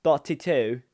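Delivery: a loud, hammed-up British accent on Thoughty two here; bad British accent. YouTuber introducing the channel in a mock British accent. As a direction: fake a British accent for the channel name, rising at the end. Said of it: "That's my, that's my British accent".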